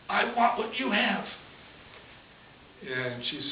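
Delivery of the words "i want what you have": high, imploring mock outburst; desperate demand. Pregnant girl grabbing the old nun, acted desperate plea. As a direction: plead urgently in a raised pitch.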